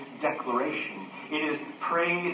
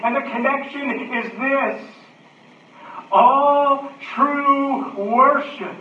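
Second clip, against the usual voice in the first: loud, raised preaching voice; full-force preaching; 7 semitones higher, 7 dB louder, slower than usual. Acted. Preacher urges the connection on the congregation, full-force preaching.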